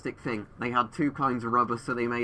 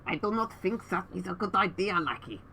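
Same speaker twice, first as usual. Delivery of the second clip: a hint of a stiff German dictator; lightly put-on German dictator voice; Hitler voice; 7 semitones higher than usual. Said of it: "he didn't sound like that".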